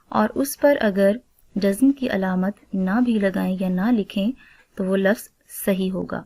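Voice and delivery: ordinary talk, nothing marked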